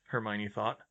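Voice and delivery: plain reading voice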